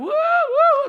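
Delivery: high mock crying wail; imitated child wailing. Preacher imitates a boy crying home from school, high wailing. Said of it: "teriak-teriak".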